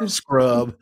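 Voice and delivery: ordinary delivery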